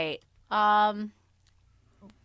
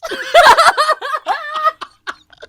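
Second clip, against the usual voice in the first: high loud burst of laughter; 18 dB louder than usual. Real, not acted. Friend laughing loud at an unknown song, laughing.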